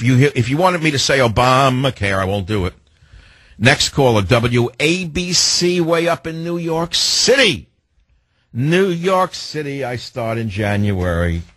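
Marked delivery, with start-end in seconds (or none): 4.2-9.8 drawn-out, higher-pitched station call; radio announcer flourish. Real, not acted. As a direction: stretch the station and city names like a showman. Radio host announces next caller's station.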